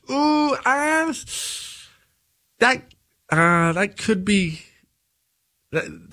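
a hint of a nervous friend hedging; hesitant objector. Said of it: "and nobody said".

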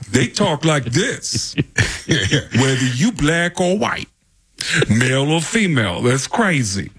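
loose, slow, drawling Bronx comic voice; Tracy Morgan impression. Talk-show guest imitating how everyone in the Bronx sounds. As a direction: do your best Tracy Morgan. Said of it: "everybody in the Bronx sounds like Tracy Morgan".

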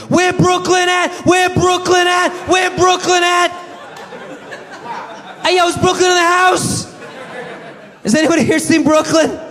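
hype man call-out. Retold or imitated. Calling through the coffee shop, shouted hype call. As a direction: call out to the crowd like a club MC. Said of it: "not to just be like".

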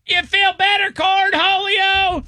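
high, drawn-out mock yell; imitated yell. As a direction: stretch the name out in a mock holler. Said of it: "the guy at the end of the TikTok just yelling".

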